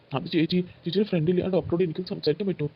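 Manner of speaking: ordinary delivery, nothing marked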